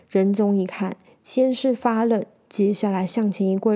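ordinary delivery

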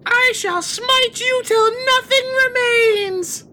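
high, slow menacing declaration; slow, high mock threat; high villain voice. Player voices character threat.

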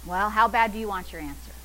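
ordinary talk

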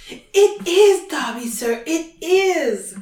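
high, slow, emphatic elf squeal; Dobby. A narrator's reading. House-elf confirms who he is to Harry, squealing. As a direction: squeal it proudly, drawing out the words. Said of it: "squealed the voice".

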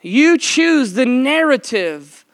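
slow, rising, emphatic preaching; preaching emphasis. Acted. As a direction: drive each point home slowly.

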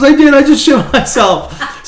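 very high loud slow wail; hammed-up distressed cry; mock wail. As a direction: drag out the confession in panic. Aunt confessing, retold.

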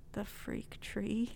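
slow whispered confession; slow embarrassed whisper. Retold or imitated. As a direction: breathe it out, mortified. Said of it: "so embarrassed".

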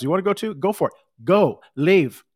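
rising to a loud, challenging send-off; defiant dismissal. Retold or imitated. Jesus daring his disciples to leave, building to loud.

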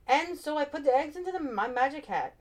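lightly voiced bumbling magician. A narrator's reading. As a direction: play the bumbling stage magician. Magician voice in story.

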